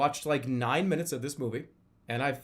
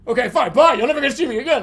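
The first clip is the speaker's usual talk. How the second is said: sulky dart guy; 9 semitones higher, 13 dB louder, faster than usual. Retold or imitated.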